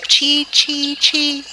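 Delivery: very high, piping bird call; another chirping bird. A narrator's reading. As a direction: cheep it out like a tiny bird. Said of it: "Then another said".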